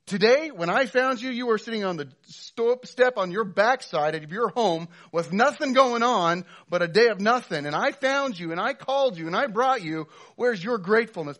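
very loud, high scolding rant; angry landowner. Pretend. Landowner scolding ungrateful workers, loud and high. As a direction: chew them out as the offended boss. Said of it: "the response here ... to be like".

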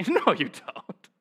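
slow laughing "No, you don't."; laughing rebuttal. Real, not acted. Preacher answering Jonah's claim, laughing disbelief. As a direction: chuckle through the denial. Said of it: "laughing rebuttal to Jonah".

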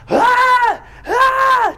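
two loud, high shrieks; imitated child's scream. Father imitating daughter still screaming, high loud shrieks. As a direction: scream like a distressed little girl. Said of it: "Nora starts screaming".

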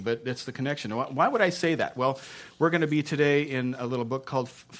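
ordinary talk

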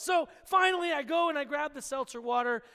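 loud, high "So finally" called out; excited exclaim. Real, not acted. Pastor relieved the salsa mix-up is solved, loud and excited. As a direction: throw it out with a burst of energy. Story punchline resolution.

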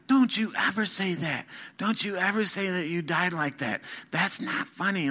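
put-on retelling mom snapping at her teenage son; mom scolding. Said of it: "She got so mad at me".

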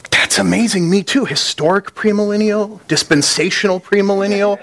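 a preacher gushing at the jumper, excitedly; gushing excitement. Retold or imitated. Gushing with high, excited delight. Excited fellow believer on bridge.

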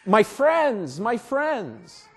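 imagine a wounded man calling for his friends; pained cry. Pretend. Pastor imagining Jesus crying for his friends, anguished. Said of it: "Jesus didn't cry out".

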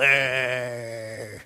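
a hint of a grumbling scoffer, low; lightly voiced Pharisee grumbling at sinners. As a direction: sneer it like a sour old Pharisee. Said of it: "They just grumble".

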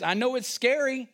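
normal talking voice

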